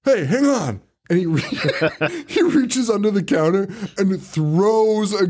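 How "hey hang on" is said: casual call. Retold or imitated. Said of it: "the guy's like".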